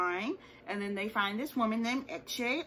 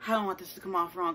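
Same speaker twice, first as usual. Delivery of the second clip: ordinary talk, nothing marked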